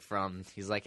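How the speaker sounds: ordinary delivery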